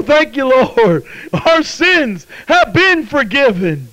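high, drawn-out thanks; fervent praise. Real, not acted. Preacher thanking the Lord for forgiveness, fervent. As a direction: cry it out slowly in praise.

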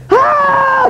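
very high acted shriek of pain; agonized scream. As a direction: scream as the burn flares up. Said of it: "He'd scream".

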